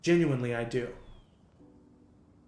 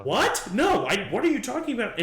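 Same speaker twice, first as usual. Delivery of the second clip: much higher, louder baffled protest; confused surprise; 10 semitones higher, 8 dB louder than usual. Acted. Derek denies sending the message, baffled protest. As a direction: react like you have no idea.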